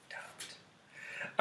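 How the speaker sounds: breathy one-word warning; whispered aside. Pretend. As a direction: whisper it as a joke. Joking aside.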